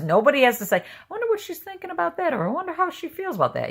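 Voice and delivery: very high, quick imitation of curious people; put-on high voice, lightly mocking their wondering. Pastor imitating people guessing her thoughts, high mock wondering.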